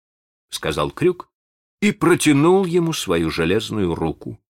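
usual speaking voice

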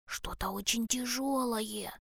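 straining whisper. A narrator's reading. Bear cub hauling a heavy catch, hushed whisper. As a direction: say it under the breath, as if straining. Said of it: "шепнул медвежонок".

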